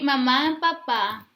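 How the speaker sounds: ordinary talk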